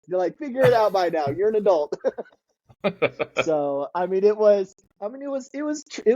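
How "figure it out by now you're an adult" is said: high, playful telling-off; lightly hammed-up parental refusal; mock scolding. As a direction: brush off a grown child in jest. Acting parents' refusal, laughing.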